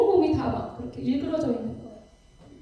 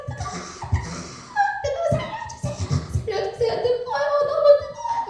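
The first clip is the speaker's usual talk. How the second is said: much higher, desperate cries of pain; screaming in agony; 14 semitones higher than usual. Retold or imitated. Preacher voicing the damned in the lava, high anguished screaming. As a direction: wail like someone burning alive. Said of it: "막 소리를 지르는데".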